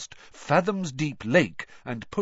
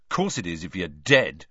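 snapping; 4 semitones lower than usual. Acted. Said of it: "snapped Ron".

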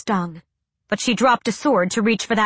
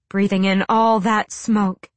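plain reading voice

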